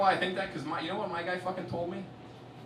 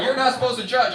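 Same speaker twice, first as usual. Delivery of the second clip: mock scolding group; 10 semitones higher, 11 dB louder than usual. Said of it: "They're like".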